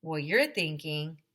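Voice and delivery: ordinary delivery, nothing marked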